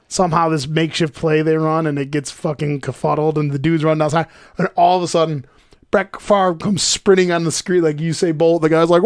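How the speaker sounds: ordinary delivery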